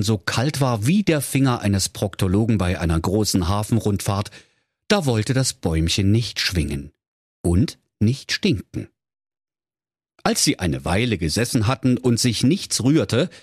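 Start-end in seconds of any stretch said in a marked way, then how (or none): none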